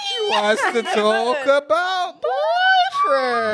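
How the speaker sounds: high, slow whining wail; mock wailing. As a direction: wail it like a fake cry. Mock wail about boyfriends.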